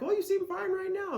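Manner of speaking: high mock voice of a doubter; mocking skeptic. Mimicking a doubter who thinks she seems fine, high-pitched. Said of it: "so if you're like".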